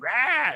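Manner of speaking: high mock roar; crowd outcry imitated. Retelling everybody's reaction to the news, high mock outcry. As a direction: imitate an outraged crowd's yell. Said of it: "everybody was like".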